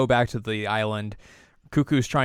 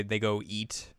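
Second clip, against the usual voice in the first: usual speaking voice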